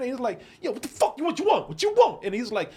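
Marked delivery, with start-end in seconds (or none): 0.6-2.2 confrontational retort climbing higher and louder; imitating the bully. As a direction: mimic a kid picking a fight, ever higher. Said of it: "I'm gonna just talk like he talk".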